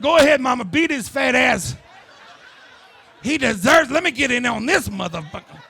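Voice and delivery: loud, rowdy cheering on the beating; loud, rowdy mock cheer from a bystander; egging-on onlooker mom. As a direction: hype her up like a parking lot fight. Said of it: "other mothers were gathering around her".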